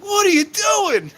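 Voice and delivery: high, slow, loud yell from a toilet stall; mock yell. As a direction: bellow it out like the buddy in the stall. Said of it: "just start yelling stuff".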